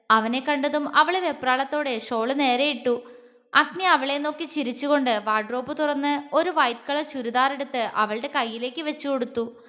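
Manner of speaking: ordinary delivery, nothing marked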